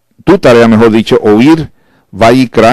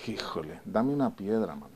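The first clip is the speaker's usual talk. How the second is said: much softer fed-up sigh; softer mock exasperated wife; 3 semitones lower, 22 dB quieter than usual. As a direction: groan it under your breath. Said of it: "Y la chava dice".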